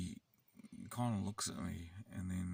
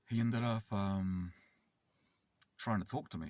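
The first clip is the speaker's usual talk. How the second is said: ordinary delivery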